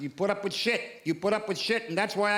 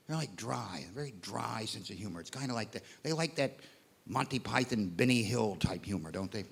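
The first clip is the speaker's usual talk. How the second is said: mock clueless American; 6 semitones lower, 8 dB quieter than usual. Continues mimicking Americans.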